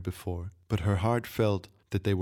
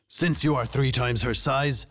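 raised, declaiming royal voice; king passing sentence; 5 semitones higher, 3 dB louder than usual. A narrator's reading. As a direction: proclaim the punishment before the whole camp. King pronounces sentence on guard.

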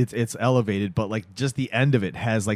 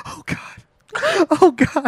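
high, squeaky mock sob; mock crying; much slower than usual. Husband turns away and starts crying, hammed-up sobbing. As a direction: squeeze it out as if weeping. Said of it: "And then he starts crying".

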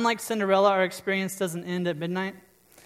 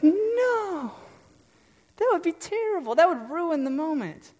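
put-on much higher child's voice, dismayed; little boy protesting; 11 semitones higher than usual. Pastor voicing her son about his toy, high dismayed child voice. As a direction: play the son aghast at losing his toy. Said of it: "He'd be like".

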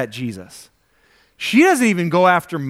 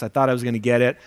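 normal talking voice

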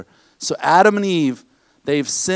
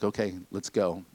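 low quiet herding; 9 semitones lower, 11 dB quieter than usual. Retold or imitated. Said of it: "He's like".

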